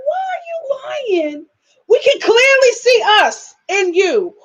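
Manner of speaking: high, drawn-out mock disbelief; exasperated challenge. Calling out a friend who lies about her parents, exasperated. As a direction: retell it as an incredulous, stretched-out challenge.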